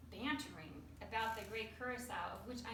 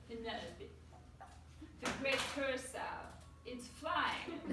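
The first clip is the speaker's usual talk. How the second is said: lightly acted, higher wonder; imitated amazed tourist; 3 semitones higher than usual. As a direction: play the French guy's amazement. Just about then, the French guy.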